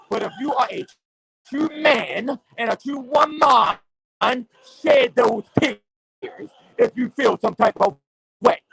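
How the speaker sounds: impassioned street preacher rant; fired-up preaching. Real, not acted. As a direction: rail at the listeners like a pulpit sermon.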